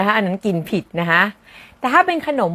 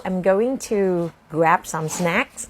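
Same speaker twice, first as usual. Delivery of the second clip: slow model phrase; much slower than usual. Shown as a demonstration. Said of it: "model sentence".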